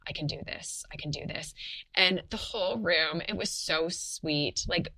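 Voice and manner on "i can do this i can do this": soft whispered self-affirmation; whispering to herself. Retold or imitated. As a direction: murmur it to yourself to keep going. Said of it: "whispering to myself".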